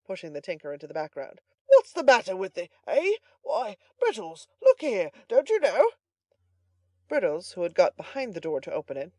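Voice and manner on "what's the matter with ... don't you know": loud, high, blustering demands; valiant bluster. A narrator's reading. Butler confronting the boy and calling Brittles, loud bluster. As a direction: fire off startled questions with bluster. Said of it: "exclaimed Mister Giles valiantly".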